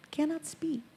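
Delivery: tossed-off quiet punchline; deadpan aside. Pretend. To the audience, a quiet deadpan punchline. Comic aside: he cannot speak.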